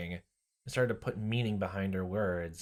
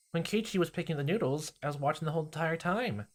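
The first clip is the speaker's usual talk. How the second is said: put-on creepy girl voice; 7 semitones higher than usual. Rena voiced high.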